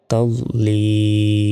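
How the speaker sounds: তাদলিল with a very long held ee; three-second stretch. Shown as a demonstration. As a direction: hold the li of তাদলিল before closing on l. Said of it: "থামলে এখানে তিন second টান হবে".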